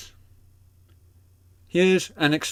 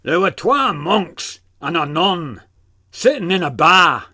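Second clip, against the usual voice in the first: Glaswegian accent; 4 semitones higher, 6 dB louder than usual. Shown as a demonstration. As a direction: tell the joke in broad Glasgow.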